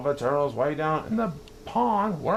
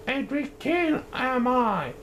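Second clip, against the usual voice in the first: high, slow self-praise; smug king; 11 semitones higher, slower than usual. Acted. Yertle repeating his praise all morning, high and slow. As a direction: savour each word of your own greatness.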